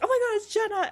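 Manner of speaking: high, loud excited squeal; excited fan. Retold or imitated. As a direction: gush like a starstruck fan. Fans recognizing the DJ.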